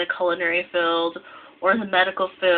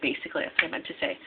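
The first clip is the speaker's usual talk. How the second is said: quick whispered aside; 3 semitones lower, 7 dB quieter, much faster than usual. Real, not acted. Self-correcting aside.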